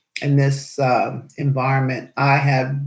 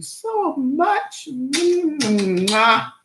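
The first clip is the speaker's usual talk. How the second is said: affectionate; 9 semitones higher, much slower than usual. Real, not acted. Sending love to Bob, kiss.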